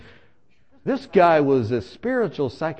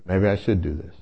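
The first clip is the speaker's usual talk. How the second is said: lower, pondering repeat; grave reconsidering; 9 semitones lower than usual. Retold or imitated. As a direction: drop low as the idea sinks in. Repeats idea, more gravely.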